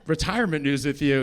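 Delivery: normal talking voice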